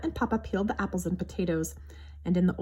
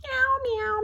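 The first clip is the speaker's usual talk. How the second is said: much higher, louder cat cry; kitten meow; 5 dB louder than usual. A narrator's reading. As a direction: mew like a stuck kitten. Kitten crying from the tree.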